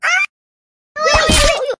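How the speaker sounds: high, loud yelps of pain; cry of pain. Acted. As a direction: yell out as the pain hits. Pain cry after falling. (ನೋವಿನ ಜೋರಾದ ಕಿರುಚಾಟ)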